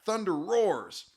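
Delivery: ordinary delivery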